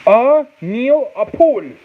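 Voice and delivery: high, slow "A Neil Apul"; singsong syllables on "A Neil Apul"; exaggerated pronunciation. Shown as a demonstration. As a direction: draw out "A Neil Apul" high, then drop at the end. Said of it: "How do you say it".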